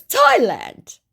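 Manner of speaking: high, slow, syrupy put-on voice; mock scammer voice. Woman reading the scammer's message aloud, in a high mock voice. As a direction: ham up the creepy flattery.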